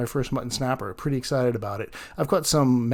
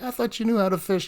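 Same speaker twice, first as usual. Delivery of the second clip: higher-pitched mock taunt; imitated teasing; 9 semitones higher than usual. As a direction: tease her, imitating the guy. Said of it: "the guy was kinda making fun of her".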